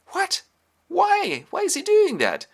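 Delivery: very high, loud disbelief; acted alarm. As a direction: squeal the questions in shock. Learns of death sentence.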